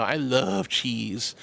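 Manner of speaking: drawn out very slowly, softly; longing, stretched-out sigh of a line; slow dreamy craving. Real, not acted. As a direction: savor each word slowly. Craving cheese.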